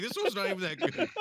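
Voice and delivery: downplaying. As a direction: pretend the belt is no big deal. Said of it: "Axel's, like, downplaying it".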